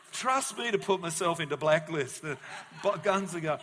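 loud, laughing through the words. Real, not acted. Preacher cracking up at his own Netflix joke, laughing. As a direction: laugh while you say it.

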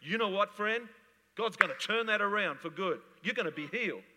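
loud, high preaching proclamation; voice of dynamic faith. Acted. Faith speaking to a sick friend, loud proclaiming. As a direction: declare healing like a prophet. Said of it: "dynamic faith is speaking to me".

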